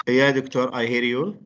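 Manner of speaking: ordinary talk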